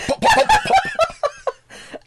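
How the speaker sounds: laughing high pop-pop sounds; high popping noises. Retold or imitated. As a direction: squeak out firework pops while laughing. Imitates firework sounds.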